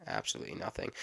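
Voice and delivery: low, soft unvoiced whisper. Shown as a demonstration. Vlogger muttering to himself about his empty notebook, whispered. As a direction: breathe it out as a deadpan aside.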